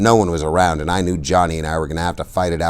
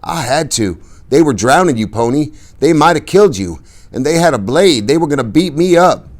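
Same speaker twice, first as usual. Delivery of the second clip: higher, louder shaky plea; a touch of quaver; 9 semitones higher, 4 dB louder than usual. A narrator's reading. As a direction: let the voice tremble. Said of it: "His voice quavered slightly".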